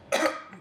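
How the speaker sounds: sudden coughing fit; choking cough. Real, not acted. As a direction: cough as a drink goes down wrong. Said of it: "something going down the wrong way".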